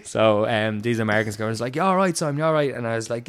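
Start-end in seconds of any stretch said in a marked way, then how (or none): 1.8-2.7 concerned American. Retold or imitated. Americans checking on him.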